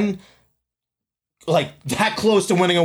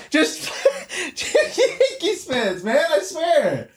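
high, drawn-out words through laughter; laughing exasperation; 12 semitones higher, much slower than usual. Real, not acted. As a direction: laugh helplessly while complaining. Laughing at a salty fan.

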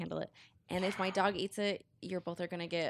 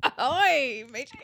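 loud pirate greeting; 5 semitones higher, 9 dB louder, slower than usual. Pretend. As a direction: hail them like a pirate. Playing pirate during the poem.

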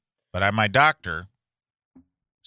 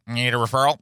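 higher-pitched, buzzing fly-like doctor; mumbling country doctor; 4 semitones higher, 5 dB louder than usual. Retold or imitated. Doctor tells patient about gallstones, mumbling impression. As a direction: imitate a doctor who talks like a fly. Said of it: "He's just like".

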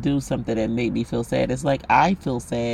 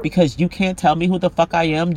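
ordinary delivery

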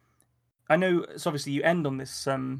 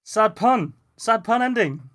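loud, high mock announcement; calling out; 7 semitones higher, 9 dB louder, slower than usual. Real, not acted. Listener reacting to the story's pun ending, called out loudly. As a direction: call it out loud and high, like a heckle.

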